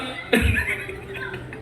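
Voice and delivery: unvoiced breathy laugh. Real, not acted. Preacher laughing at his own story, a breathy laugh.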